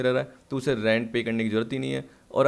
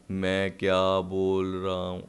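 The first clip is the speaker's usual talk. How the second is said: deliberately dragged demo of a slowed-down lecture; slow speech demo; 6 semitones lower, much slower than usual. Shown as a demonstration. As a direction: show how slowly he could talk. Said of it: "धीरे धीरे बोलूँगा".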